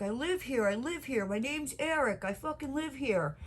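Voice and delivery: a hint of a desperate drunk; pleading drunk stranger. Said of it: "he's like".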